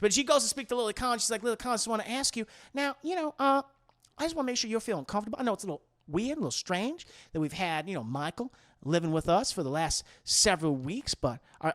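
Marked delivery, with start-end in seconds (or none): 1.4-11.7 soft, hesitant motherly inquiry; gentle checking-in. Acted. Leanne asks Lily whether she feels comfortable, softly and tentatively. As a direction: tread carefully, keeping the voice down.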